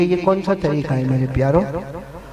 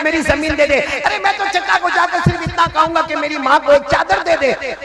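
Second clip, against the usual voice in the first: higher voice, alarmed then pleading; pleading voices in a story; 9 semitones higher than usual. Acted. Preacher acting out mother forbidding the son, high and pleading. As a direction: voice the frightened mother and the begging boy.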